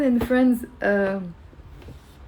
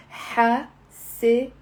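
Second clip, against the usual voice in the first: syllable by syllable. Shown as a demonstration. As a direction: pause between each syllable of حساسية. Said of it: "It's four syllables".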